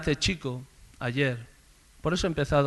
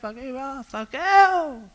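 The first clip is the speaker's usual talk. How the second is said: crowd jeering; 10 semitones higher, slower than usual. Acted. Crowd mocking Zacchaeus, high jeer. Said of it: "la gran multitud gritando".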